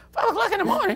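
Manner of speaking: incredulous laughing yelp; shocked disbelief. Retold or imitated. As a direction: gasp it out in amused disbelief. Shocked at early practice time.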